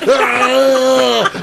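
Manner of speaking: the date screaming behind the boat, mock terror. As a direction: cry out as you're dragged. Screaming on skis in story.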